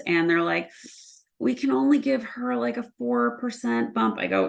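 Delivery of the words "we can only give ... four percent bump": a higher, mildly mock HR voice offering a small raise; mimicking HR. Leader mimics HR refusing a bigger raise, mildly mocking. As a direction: lightly send up the stingy HR reply.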